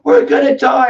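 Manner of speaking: high, loud mock panic, hammed-up; imitating scared people, overplayed. People panicking over the mask mandate, mock fearful cry. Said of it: "all of a sudden everyone's like".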